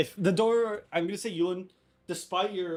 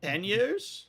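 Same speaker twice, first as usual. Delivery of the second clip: higher questioning voice; higher-pitched cook character; [name] in character; 6 semitones higher than usual. Acted. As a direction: answer higher, as if unsure. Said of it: "Turn to [name]".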